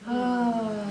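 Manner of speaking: high, loud drawn-out "ahh"; long exhaled sigh. Shown as a demonstration. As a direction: let the whole breath out on a big "ah". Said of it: "Take a deep breath".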